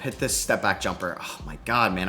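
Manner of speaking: ordinary talk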